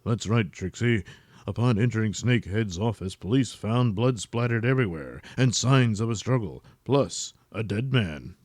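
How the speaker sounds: low, measured anchorman read; news anchor. Acted. Wiener Wanker reporting the monster attack, low anchorman voice. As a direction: deliver the news with mock gravity.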